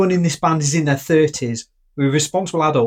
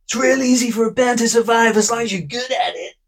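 higher American rocker voice; 7 semitones higher than usual. Acted. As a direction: put on a brash American rock star accent. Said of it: "I'll try and do the voice".

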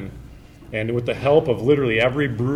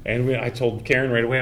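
usual speaking voice

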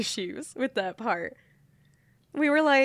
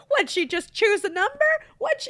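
indignant outburst; 10 semitones higher, 3 dB louder than usual. Retold or imitated. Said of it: "she was so mad".

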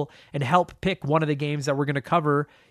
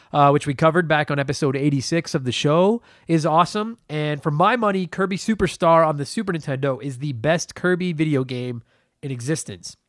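ordinary talk

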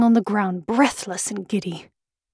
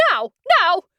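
Queen crying out; 8 semitones higher than usual. A narrator's reading. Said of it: "cried the Queen".

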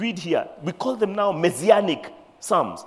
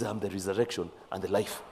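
solemn Jesus voice; 9 semitones lower, 10 dB quieter than usual. Acted. Preacher voices Jesus' answer.

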